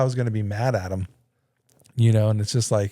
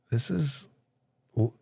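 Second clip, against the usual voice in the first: ordinary talk, nothing marked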